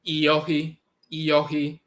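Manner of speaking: iyohi as ee-yo-hee, long final ee; pronounced iyohi. Shown as a demonstration. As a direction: sound out iyohi slowly. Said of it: "That's another variation".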